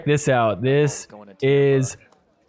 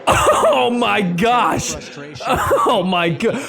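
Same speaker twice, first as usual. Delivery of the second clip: stunned; 5 semitones higher, much slower than usual. Real, not acted. Reacting to self-smacking.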